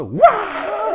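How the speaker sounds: high, sudden "waah"; spring out with a high startling yell; scare yell. Retold or imitated. Teller ambushing recruits under a log bridge, yelling.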